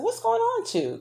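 reaching out to a husband who seems off; alarmed question. Retold or imitated. Startled asking husband what's wrong.

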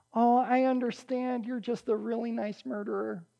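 soft, syrupy pretend understanding; mock sympathy. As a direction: coo it gently, as if excusing someone. Mock leniency toward a murderer.

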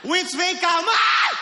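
high shouted angry mother; mock furious mother shouting; mom calling. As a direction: yell for the kid to come here. Mother calling child to punish.

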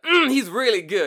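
high, loud preacher's reply; hammed-up pulpit enthusiasm; mock preacher fervor. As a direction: belt out an assured answer. Preacher answering, building up.

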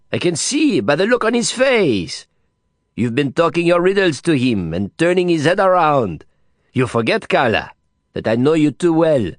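a flamboyant foreigner chiding playfully; Didier, mocking.

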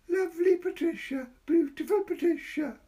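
put-on high coaxing child voice; wheedling child. A narrator's reading. Boy flattering Patricia to get a ride. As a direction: sweet-talk her like a little boy.

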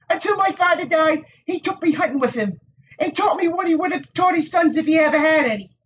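put-on high, tart young woman's voice; prickly village Wisdom. A narrator's reading. Nynaeve to the Aes Sedai, tart and high. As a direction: needle him with a sharp tongue.

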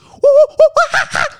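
huge shrieking ape howl; wild ape scream. Retold or imitated. Comic imitating an ape attacking a shopper, shrieking. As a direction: explode into a primal monkey shriek.

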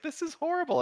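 high-pitched imitation of an appalled wife; put-on high voice of a distressed wife; horrified wife. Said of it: "my wife was just like".

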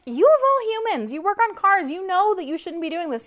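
high, incredulous scolding; exasperated retort. Retold or imitated. To her husband about the trailer, high incredulous scolding. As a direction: scold him in disbelief. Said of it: "And I'm like".

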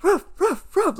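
high yappy mock barks; imitating a dog barking. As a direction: bark it out like an excited puppy. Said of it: "like, barking at them".

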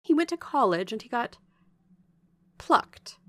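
lively, emphatic servant's chatter; gossipy Bessie, confiding. Acted. Bessie telling Jane how John Reed is doing, confiding gossip. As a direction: pass on the family news with swooping pitch.

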